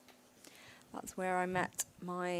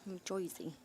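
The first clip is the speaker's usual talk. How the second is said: put-on softer, murmured Jersey accent; "New Jersey" as a quiet "New Joizy"; 6 dB quieter than usual. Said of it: "Can you do a New Jersey accent".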